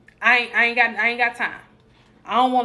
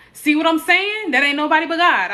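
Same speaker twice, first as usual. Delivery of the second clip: excited praise; 6 semitones higher, 7 dB louder than usual. Real, not acted. Streamer hyping her viewer's answer, excited praise. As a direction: proclaim it like a revelation.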